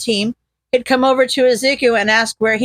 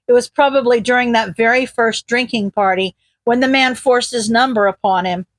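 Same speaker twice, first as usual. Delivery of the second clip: plain reading voice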